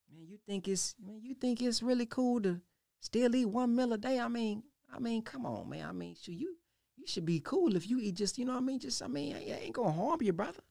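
soft, coaxing tempter; tempter's voice. Retold or imitated. Devil casting an evil suggestion, soft and coaxing. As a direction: lull him like a smooth tempter. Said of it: "it's talking about the evil suggestions".

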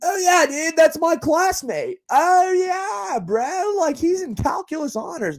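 high-pitched, eager bro voice, mocking.

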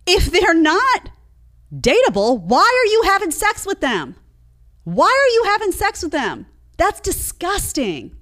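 high-pitched appalled outburst; appalled scolding. Real, not acted. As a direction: scold them high and incredulous. Scolding the women in disbelief.

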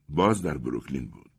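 usual speaking voice

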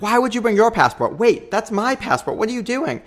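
high, rising bewildered questions; confused alarm. Retold or imitated. Boyfriend finds his passport at the airport, confused and high-pitched.

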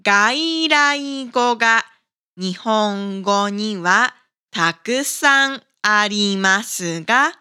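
normal talking voice

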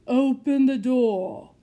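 luid, langzaam bevel van een hooghartige heer; bevelende heer. Acted. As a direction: beveel het als een deftige lord. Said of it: "instructed the gentleman".

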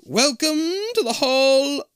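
high, slow, eerie disembodied voice; hollow echoing voice. Acted. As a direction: echo it out like a ghost in the walls. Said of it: "a hollow voice echoes".

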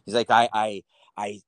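put-on slow, dreamy, lightly French-accented; a hint of a Frenchman recalling his best time, slowly. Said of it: "This guy's name's Yusef".

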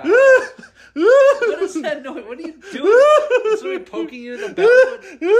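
high, loud, slow giggling question; laughing. Acted. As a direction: crack up while asking. Laughing, mock alarm at noises.

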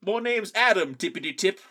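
hammed-up British introduction; British accent. Co-host introducing himself in a silly British accent. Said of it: "I cannot do my British accent".

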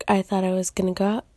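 normal talking voice

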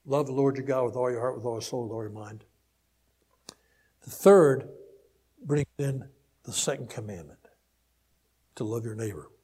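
ordinary delivery

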